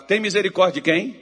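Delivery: loud, rising call-out. Shown as a demonstration. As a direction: demand a stronger answer from the crowd. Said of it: "Tá fraco".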